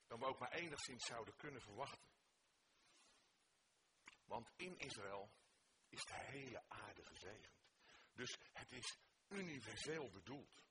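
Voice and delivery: ordinary delivery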